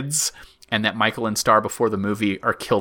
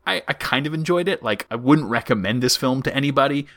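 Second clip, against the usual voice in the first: normal talking voice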